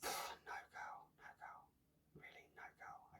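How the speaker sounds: barely audible whisper; hushed, voiceless aside. Real, not acted. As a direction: whisper it under your breath, very softly. Muttered aside to herself.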